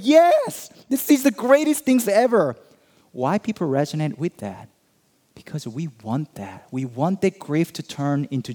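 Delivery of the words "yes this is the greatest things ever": much higher and louder, jubilant; excited cheering. Retold or imitated. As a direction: burst out in cheering joy. Retelling cheering movie crowd.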